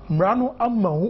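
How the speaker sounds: ordinary talk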